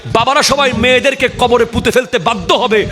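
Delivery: high-pitched fiery sermon delivery; impassioned hellfire preaching; full-force preaching. Real, not acted. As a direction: warn the congregation with rising, ringing intensity.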